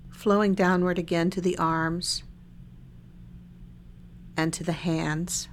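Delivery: calm body-scan guidance with long silences between phrases; unhurried, even meditation-guide voice; guided meditation. Real, not acted. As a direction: lead listeners gently through a meditation, leaving lots of space.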